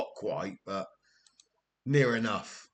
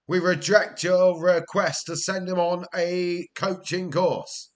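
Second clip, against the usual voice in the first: mocking the board; 6 semitones higher, 5 dB louder than usual. Reading the board's refusal aloud.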